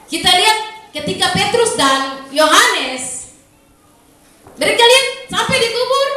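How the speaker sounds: high, fervent preaching voice. Acted. Pastor stirring the congregation, fervent high preaching.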